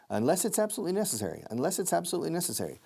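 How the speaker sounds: quick, run-together; fast linked. Shown as a demonstration. Teacher showing fast linked speech. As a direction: rattle it off with the linking. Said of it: "listen to the linking".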